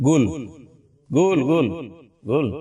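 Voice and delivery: গুল with a hard g in place of k; imitating Arabs saying গুল. Shown as a demonstration. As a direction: say গুল, swapping the k of কুল for g. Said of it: "তো আরবিরা কি বলছে?".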